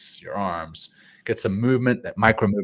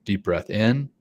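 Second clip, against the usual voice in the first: guided breathing. Acted. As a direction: coach the breaths gently. Leads breathing exercise.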